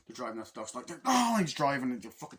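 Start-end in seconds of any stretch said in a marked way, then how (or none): 1.0-1.4 high, loud "oh" of panic; imitated cry mid car chase; hammed-up movie shout; mock alarmed yell. Acting alarm in the action scene.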